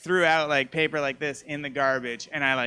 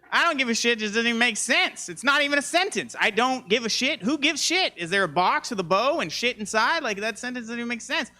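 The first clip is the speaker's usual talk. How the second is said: rising rant; 7 semitones higher than usual. Real, not acted. Escalating rant about the phrase.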